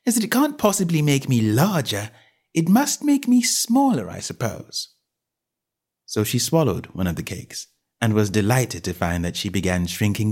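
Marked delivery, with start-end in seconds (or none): 0.1-4.9 reasoning aloud. A narrator's reading. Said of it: "she thought".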